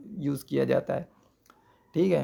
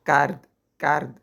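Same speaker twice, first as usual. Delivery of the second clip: slow emphatic model word; 4 dB louder, slower than usual. Shown as a demonstration. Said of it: "کیا بنے گا ماضی مطلق کا صیغہ".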